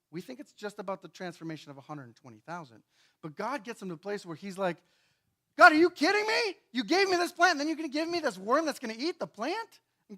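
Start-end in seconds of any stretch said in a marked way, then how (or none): 5.6-9.8 high, loud whiny protest; mock outrage. Jonah protesting to God about the worm, high mock outrage. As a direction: complain to God in disbelief.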